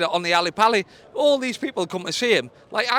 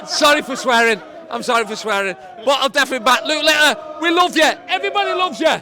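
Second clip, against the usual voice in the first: loud, excited fan yelling; excited shouting; 3 semitones higher, 4 dB louder than usual. Real, not acted. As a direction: shout it over the crowd. Drunk fan shouting a message.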